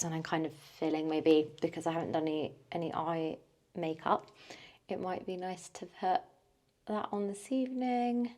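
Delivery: ordinary talk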